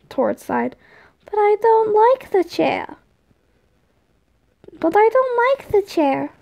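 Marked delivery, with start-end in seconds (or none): 1.2-6.3 put-on high childish whine; whiny little complaint, pitched up; whining. A narrator's reading. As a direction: whine it like a sulky kid. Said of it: "Tom whined".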